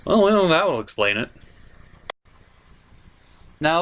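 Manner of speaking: ordinary talk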